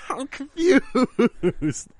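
high, slow, dissolving into laughter; laughing. Real, not acted. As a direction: barely get it out for laughing. Cracking up at the lyric.

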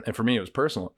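ordinary talk, nothing marked

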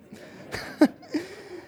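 high, breathy laughter of relief; a laugh that spills out after crying; tearful laugh. Retold or imitated. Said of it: "And she laughs".

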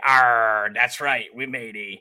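lightly growled pirate "arr"; a hint of a pirate, played for fun. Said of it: "National Talk Like a Pirate Day".

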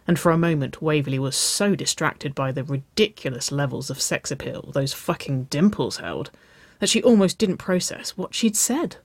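ordinary delivery

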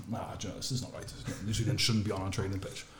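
hushed grumble to himself about the intruders; muttered thought. Retold or imitated. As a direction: mutter it under your breath, annoyed. Retold thought about rival team.

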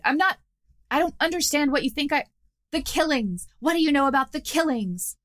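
a hint of a jittery goblin demanding answers; rattled goblin boss. Goblin boss Krenko interrogates, rattled.

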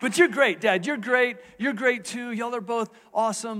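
put-on high, loud, eager kid voice, reassuring his dad; eager little boy. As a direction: son quickly assures dad both are great, high and eager. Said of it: "And then he goes".

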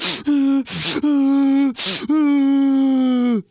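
loud, high mock sobbing wail; imitated crying. Comedian mimics himself crying at Rocky, loud mock wailing. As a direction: bawl in drawn-out fake sobs. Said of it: "I was like".

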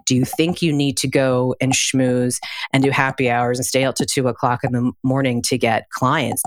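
ordinary talk, nothing marked

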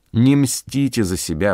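ordinary delivery

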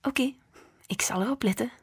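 soft, half-whispered restraint; forced calm. A narrator's reading. Mother swallowing her anger at grandma, soft and tight. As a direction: keep your temper down to a whisper. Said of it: "Ze ademde diep in en uit".

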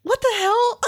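high incredulous what the hell; mock outrage. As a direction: squeal it in disbelief. Mock outraged reaction to memo.

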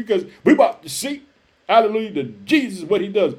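usual speaking voice

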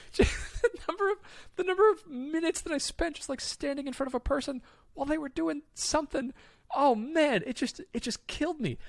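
high, laughing exasperation; exasperated. Real, not acted. Recalling waits at Gap Burger, high laughing exasperation. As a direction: complain with amused disbelief.

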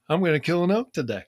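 high, loud and fast, bursting with confidence; excited certainty. Retold or imitated. To his buddy Brian, sure of the kill: high, fast, excited. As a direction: blurt it out like you can't contain the hunch. Telling hunting buddy he'll succeed.

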